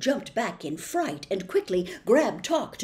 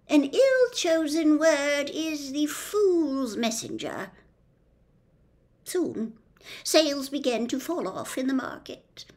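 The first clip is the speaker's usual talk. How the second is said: put-on lightly higher, wistful old lady; old witch storyteller; 7 semitones higher than usual. A narrator's reading. The witch telling Milo of her downfall, lightly higher voice. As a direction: tell her story with regret.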